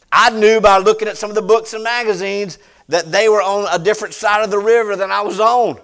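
loud, high-pitched preaching at full force. Real, not acted. Preacher telling the congregation about the lady's house, preaching loudly. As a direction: push the story out to the whole room.

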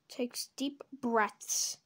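usual speaking voice

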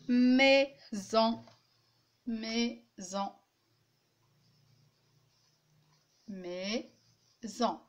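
"maison" split as mai, pause, son; syllable by syllable. Shown as a demonstration. Teacher giving a new example word, split in syllables. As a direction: separate both beats of "maison" clearly. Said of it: "Si je dis le mot".

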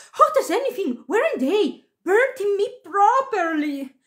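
high-pitched, agitated exclaiming; indignant cry. A narrator's reading. Principal girl blows on burnt fingers, high agitated cry. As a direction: complain in a high cry of alarm. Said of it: "cried the principal girl".

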